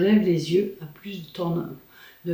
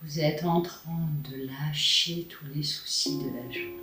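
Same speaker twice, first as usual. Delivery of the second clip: sultry narrator. Acted. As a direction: guide them gently into calm. Leads a face yoga relaxation.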